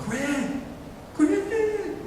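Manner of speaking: high, soft falsetto call; mock distant yell. Red-haired girl calling to Greg across auditorium, mock yell. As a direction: imitate a girl yelling from afar without raising the volume. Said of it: "yelling at me".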